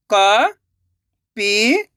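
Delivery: very slow, stretched syllables, rising at the end; sounding out syllables. Shown as a demonstration. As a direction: sound it out slowly, letter by letter. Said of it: "एक एक अक्षर का उच्चारण करके".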